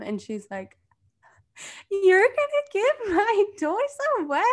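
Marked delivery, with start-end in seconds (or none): 1.9-4.4 high-pitched mock kid wail; hammed-up little-kid outrage, pitched way up; distraught child. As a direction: mimic a child's dismayed protest. Imitates upset child's reaction.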